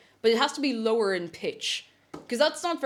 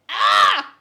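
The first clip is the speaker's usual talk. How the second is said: loud, higher scream try; cartoon scream attempt; 10 semitones higher, 10 dB louder than usual. Shown as a demonstration. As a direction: yelp it like a cartoon cat. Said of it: "It doesn't sound like that".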